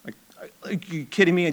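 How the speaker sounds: incredulous stage whisper; mock whisper. Mock disbelief at Siri change.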